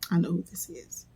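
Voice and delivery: whispered note to self; muttered aside. Real, not acted. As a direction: drop to a near-whisper, talking to yourself. Aside while checking who it is.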